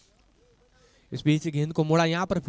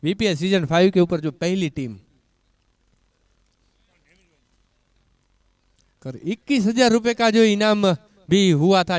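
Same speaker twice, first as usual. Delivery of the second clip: excited announcement; 5 semitones higher, 8 dB louder than usual. Real, not acted. Announcing the prize.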